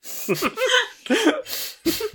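shrieking laugh; high burst of laughter. Real, not acted. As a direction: cackle out loud, high. Laughing at the chicken joke.

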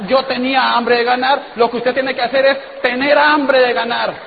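loud, rousing preacher's shout; rally them, hammering the point; a shouted sermon on hunger to win; fired-up shout. Real, not acted. Pumping up the crowd.

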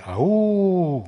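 high, loud howl like a wolf. A narrator's reading. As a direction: howl to the mountains. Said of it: "Y luego yo grité". (aullido de lobo, agudo y fuerte)

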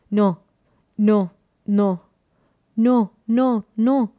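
loud, separated cries of refusal, rising later; mild wail, each word set apart; anguished inner cries. A narrator's reading. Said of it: "Voces interiores que lloran".

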